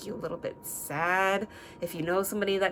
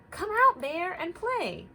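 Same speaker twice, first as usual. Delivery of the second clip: high, loud calling voice; friends calling out; 12 semitones higher, 6 dB louder, slower than usual. Acted. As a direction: call Bear out to play.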